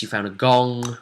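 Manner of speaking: ordinary talk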